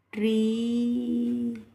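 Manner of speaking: ट्री drawn out very slowly with a long ee; ट्री stretched, long ee sound; long ee demo. Shown as a demonstration. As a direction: hold the ee in ट्री for learners. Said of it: "पहले वाले की आवाज़ निकालना है".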